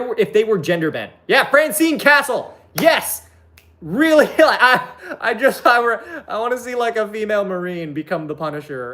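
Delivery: high, emphatic hype; carried away, breaking into a laugh; excited pleading. Real, not acted. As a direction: plead your case with giddy, high-pitched enthusiasm. Pleading the case for gender-bending.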